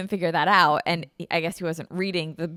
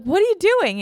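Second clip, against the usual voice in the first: yelling out; 11 semitones higher, 9 dB louder than usual. Retold or imitated. Yelling at dad from window.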